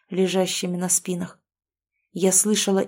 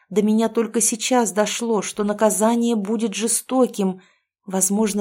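usual speaking voice

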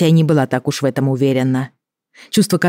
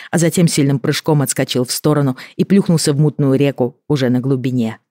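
usual speaking voice

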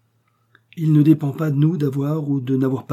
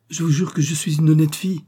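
put-on a higher, pleading girl's voice swearing her honesty; pleading young woman; 6 semitones higher, 4 dB louder than usual. A narrator's reading. Young woman begs Maxime to believe she is honest, pleading. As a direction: beg him to believe her, voice lifted.

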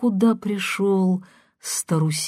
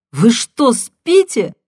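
loud, much higher, exasperated shout; 6 semitones higher, 8 dB louder than usual. A narrator's reading. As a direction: yell it at him in disbelief. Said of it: "крикнула она".

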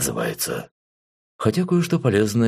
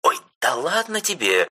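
беспечно; 7 semitones higher than usual. A narrator's reading. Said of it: "беспечно махнула она рукой".

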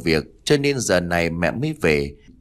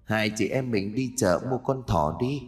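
soft, hushed ghostly invitation; cold eerie ghost voice; 6 dB quieter than usual. Acted. Ghost repeating her invitation to the frightened mother, softer and eerie. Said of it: "Giọng nói lạnh lẽo ma mị".

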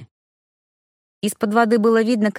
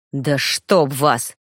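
barked; 7 semitones lower, much slower than usual. A narrator's reading. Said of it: "рявкнул напарник".

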